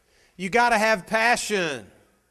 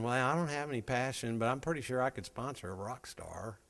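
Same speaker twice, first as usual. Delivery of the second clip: sheepish musing; 6 semitones lower, 12 dB quieter than usual. Retold or imitated. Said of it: "But if you got in thinking".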